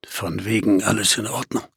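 low, quiet muttered aside; dry, sceptical retort. A narrator's reading. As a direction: drop low and soft to contradict the reassurance. Narrator's dry retort to Emily's line. (trockener, leiser Widerspruch, tief gesprochen)

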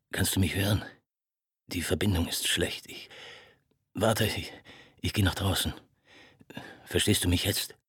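low, halting, half-whispered phone voice; a broken man struggling to get words out; brittle man's voice. Acted. Ferris calls Marthaler at night, brittle and halting. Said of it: "die brüchige Stimme eines Mannes".

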